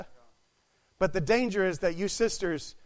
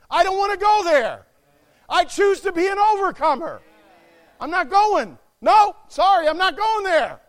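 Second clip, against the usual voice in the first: loud, high, emphatic revolt; impassioned shouted resolve; defiant refusal; 8 semitones higher, 9 dB louder than usual. Shown as a demonstration. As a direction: declare it forcefully, refusing to budge. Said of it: "You should say".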